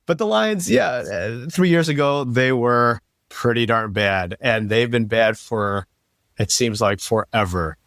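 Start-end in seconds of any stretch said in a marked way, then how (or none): 0.1-2.2 laughing. Real, not acted. Laughing about Bears' losses.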